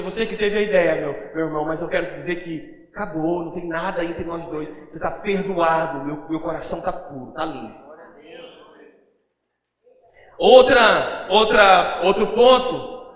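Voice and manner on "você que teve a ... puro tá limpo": low, soft brotherly forgiveness; much lower and softer, tender reconciliation; Joseph forgiving. Acted. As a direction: speak it gently to the brother who wronged you. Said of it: "ele chegou no Judá e disse".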